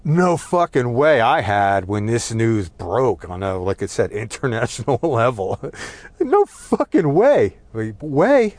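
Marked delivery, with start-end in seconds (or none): 0.0-1.2 incredulous, higher and louder than usual; disbelief. Retold or imitated. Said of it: "sense of". 6.2-8.5 loud, high-pitched, drawn-out incredulity; disbelief. Retold or imitated. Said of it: "sense of".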